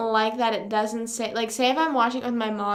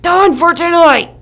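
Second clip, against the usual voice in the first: slow, loud declaration; loud outburst; 6 semitones higher, 16 dB louder than usual. Retold or imitated. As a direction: belt it out. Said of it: "And I'd be like".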